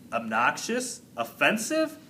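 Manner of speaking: plain reading voice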